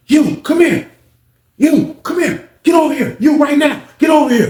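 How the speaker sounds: high, loud barking commands; imitating officer yelling. Officer yelling at inmate under the steps, loud and high. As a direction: call him over like an angry sergeant.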